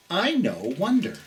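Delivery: ordinary delivery, nothing marked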